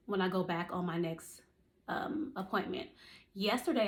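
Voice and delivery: ordinary delivery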